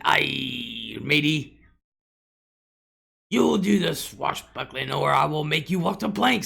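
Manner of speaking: slow, growly pirate captain. Pretend. Grandma answering granddaughter at table, hammy pirate voice. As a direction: threaten like a pretend pirate.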